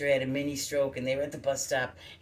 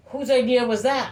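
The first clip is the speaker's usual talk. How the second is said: imitated judge, much higher and louder; the judge demanding an answer; stern judge; 11 semitones higher, 11 dB louder than usual. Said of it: "and he goes".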